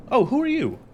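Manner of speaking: hammed-up startled shopper; surprised question. Retelling the woman's question to Lucy, high mock surprise. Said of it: "she asks".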